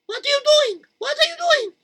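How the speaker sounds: very high alarmed mother cry; shocked mother. Acted. Mother catching Zalmi going outside, high alarm.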